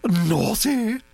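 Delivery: high, commanding grand-lady voice; a bossy old aristocrat laying down plans; imperious old Duchess. A narrator's reading. Duchess scolds teasingly.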